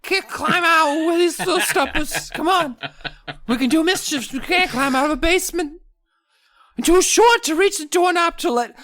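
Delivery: very high gnome voice, griping and giggly; a tiny gnome whining about stumpy legs; whiny squeaky gnome. Acted. Gnome complains about short legs.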